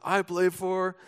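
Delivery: a hockey player shouting to his coach, only half-volume; mild mock yell. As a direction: call it out proudly to the coach, but toned down. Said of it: "he yells out".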